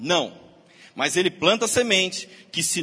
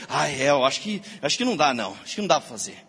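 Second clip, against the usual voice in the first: giving up; 7 semitones lower, faster than usual. Retold or imitated. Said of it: "Ele joga lá um questionamento e eu rego".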